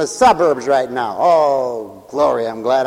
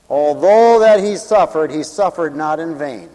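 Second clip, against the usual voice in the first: usual speaking voice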